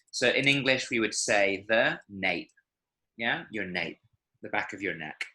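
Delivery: ordinary talk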